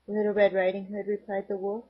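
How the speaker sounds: usual speaking voice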